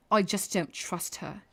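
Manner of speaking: light imitation of a British Traitors contestant; mock British accent. Mimicking British reality show contestants, mild British accent. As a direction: repeat it in a faintly British accent. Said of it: "I just repeat what they say every time 'cause their accents".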